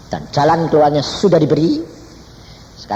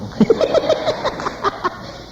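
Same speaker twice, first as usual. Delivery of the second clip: light laugh. Real, not acted. Preacher laughing at his own story, breathy. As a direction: let a little laugh slip.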